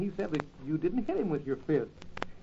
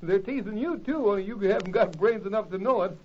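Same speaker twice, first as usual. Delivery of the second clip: usual speaking voice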